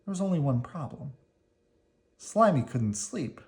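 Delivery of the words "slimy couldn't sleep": plain reading voice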